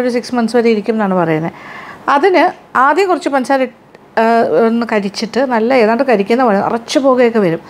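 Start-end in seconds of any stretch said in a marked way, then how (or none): none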